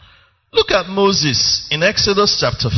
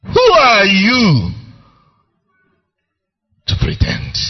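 rising challenge; 7 semitones higher, 4 dB louder than usual. Real, not acted. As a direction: drive the challenge home harder. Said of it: "preacher challenging the hearers".

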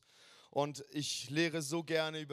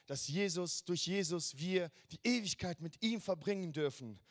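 ordinary talk, nothing marked

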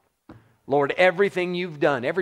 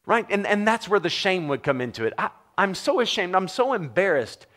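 usual speaking voice

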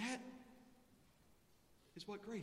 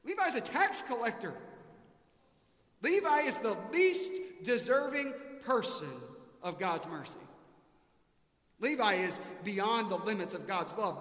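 preaching at full force; 3 semitones higher, 8 dB louder than usual. Acted. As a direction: hammer home Levi's unworthiness.

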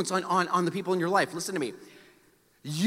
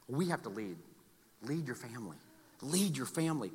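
much softer, lower and sincere; quiet earnest plea; 7 semitones lower, 13 dB quieter than usual. Real, not acted. Pastor urging parents to lead their family, soft and earnest. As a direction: drop to an urgent hush.

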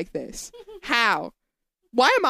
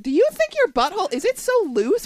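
incredulous; 7 semitones higher than usual. Retold or imitated. Quoting own incredulous thought.

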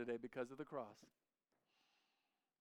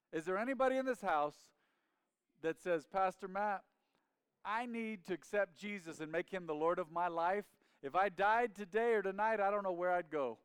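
raised, forceful appeal; altar call; 5 semitones higher, 6 dB louder than usual. Real, not acted. Preacher asking anybody to accept Jesus, forceful appeal. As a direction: call out urgently to the whole room.

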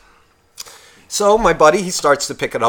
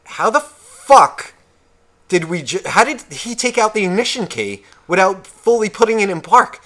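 incredulous outburst; 7 semitones higher, 3 dB louder than usual. Retold or imitated.

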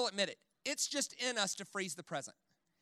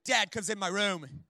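ordinary talk

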